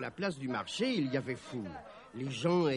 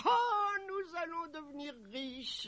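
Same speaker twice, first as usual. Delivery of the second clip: very high, swooning delight; dreamy high-pitched rapture; ecstatic mother; 12 semitones higher than usual. Acted. Overjoyed mother dreams of riches.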